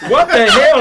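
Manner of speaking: high, loud laughing "what the hell"; laughing disbelief. Retold or imitated. Narrator baffled by the caller, laughing loud.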